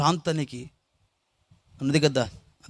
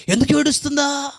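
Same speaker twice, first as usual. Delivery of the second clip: raised, pleading voice full of grief; emotional testimony; 10 semitones higher than usual. Real, not acted. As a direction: let the pain push the voice up high. Emotional peak recalling crying mother.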